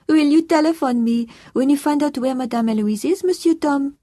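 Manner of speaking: put-on high, loud French-accented plea; anxious French housekeeper. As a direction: ask urgently as a worried French housekeeper. Housekeeper asks to be telephoned.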